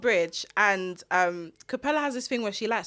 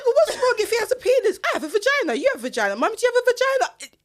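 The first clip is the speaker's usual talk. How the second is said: put-on toddler voice; 9 semitones higher, faster than usual. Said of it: "But she said".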